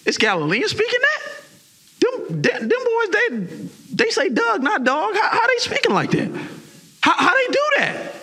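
very high, astonished mock voices; high, laughing disbelief at the Galileans; amazed crowd. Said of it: "they was like".

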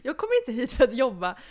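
loud mock indignation; indignant. Loud mock protest about having to work at a party. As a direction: protest loudly, half joking.

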